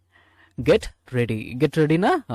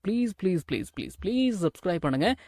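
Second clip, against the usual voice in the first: softer, repeated mock begging; playful pleading; 5 semitones higher, 4 dB quieter, faster than usual. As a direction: coax viewers with a string of pleases. Playfully begs viewers to subscribe.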